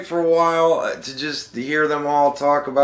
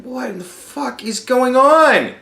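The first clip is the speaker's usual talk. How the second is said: mock disbelief; 9 semitones higher, 3 dB louder than usual. Retold disbelief at second Spanish speaker.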